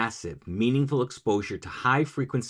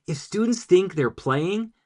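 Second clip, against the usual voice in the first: ordinary delivery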